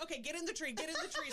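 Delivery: high, bossy wife imitation; mock urgent command. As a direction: hurry the husband along, half laughing. Acting wife ordering husband into tree.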